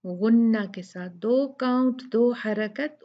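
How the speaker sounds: ordinary talk, nothing marked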